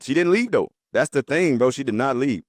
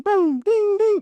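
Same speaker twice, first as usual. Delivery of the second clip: mimicked notification pings; 4 dB louder, much slower than usual. Narrator imitating the WhatsApp alerts that woke him, high pinging. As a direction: boom and ding sung out high and bright like phone alerts.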